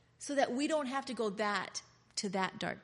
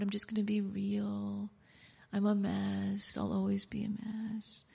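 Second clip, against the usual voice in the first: whispered mock confession, unvoiced; 3 dB quieter than usual. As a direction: breathe it like a shamed secret. Meek believer whispering.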